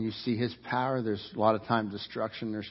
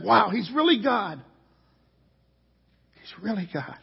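imitated awe; 9 semitones higher, 5 dB louder than usual. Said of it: "you said".

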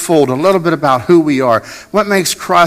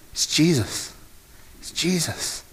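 hushed answer; 9 dB quieter, slower than usual. Real, not acted. Repeated answer, dropping to hush.

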